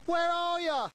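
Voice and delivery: high, drawn-out call into the dark; mock shout, pitched well up; acted shout. Husband calls out to the unseen tramp, acted shouting. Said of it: "So he shouts".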